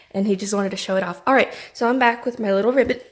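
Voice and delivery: ordinary talk, nothing marked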